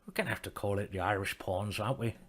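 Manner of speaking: badly faked Irish accent; terrible Irish accent. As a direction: put on a hopeless Irish accent. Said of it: "really terrible accent".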